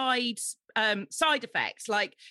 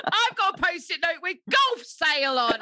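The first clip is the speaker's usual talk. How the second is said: loud, laughing, higher joke; laughing shout; 6 semitones higher, 4 dB louder than usual. Real, not acted. Joking shout over the plug.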